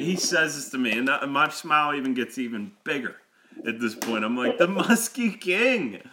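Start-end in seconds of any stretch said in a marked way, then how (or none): 4.6-6.0 high, loud, drawn-out mock awe; incredulous exclamation. Storyteller repeating the man's boast back to him, incredulous. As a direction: exclaim it slowly in retold disbelief. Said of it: "I say this out loud to him".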